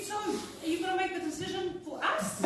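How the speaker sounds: put-on high cheeky child's question; little girl voice. Little girl asking the commissioner about the decision, high cheeky child voice. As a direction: ask like a bold little kid. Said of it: "She said".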